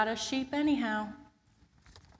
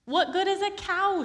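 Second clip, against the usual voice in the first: high, loud, exasperated question; exasperated Peter; 11 semitones higher, 6 dB louder than usual. Acted. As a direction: throw up your hands in disbelief. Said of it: "said Peter".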